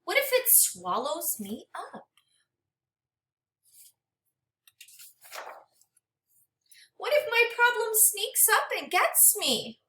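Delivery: gentle, expressive storybook read-aloud; reading to children. Acted. Teacher reading a storybook to her class, expressive and gentle. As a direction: read it like a picture book for a class.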